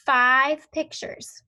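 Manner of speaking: slow and stress "five pictures". Shown as a demonstration. Teacher telling pupils how many pictures they should have, slow and firm. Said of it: "So you should have".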